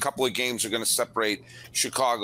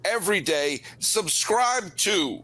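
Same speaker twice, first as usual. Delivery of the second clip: podcast host outro; 5 semitones higher than usual. Acted.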